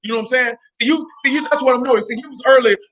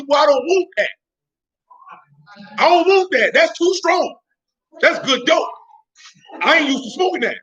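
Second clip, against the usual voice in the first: lightly acted smoker, pitched higher; mock a smoker backing off, voice raised in pitch; smoker taklidi; 5 semitones higher than usual. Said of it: "I done had smokers tell me".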